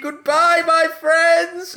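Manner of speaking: high, sung-out goodbye; mock farewell. Host reading the fan's sign-off in a hammed-up voice. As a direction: wave them off theatrically.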